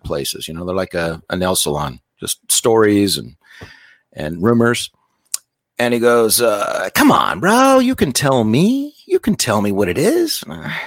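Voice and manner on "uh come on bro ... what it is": slow wheedling, pitch swooping up; coaxing. Retold or imitated. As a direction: coax a buddy into spilling a secret. Friend coaxing gossip out of him.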